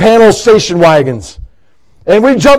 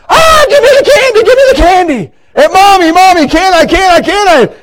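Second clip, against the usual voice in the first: put-on whiny begging child; 8 semitones higher than usual. A kid whining at his mommy for candy, high and wailing. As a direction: beg like a bratty child in the checkout lane.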